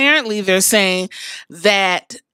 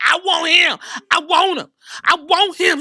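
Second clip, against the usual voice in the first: shouting; 7 semitones higher than usual. Retold or imitated. Seth demanding a fight.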